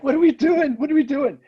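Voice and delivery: high, panicked squeal of confusion; panicked friends. Retold or imitated. Friends panicking as the storyteller flees the room, high squeal. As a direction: shriek it in high alarm. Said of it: "Tori Mitchell and Seth are like".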